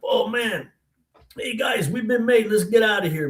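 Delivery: high, urgent warning to buddies; retold panic at being caught; alarmed. Caught boy warns his friends.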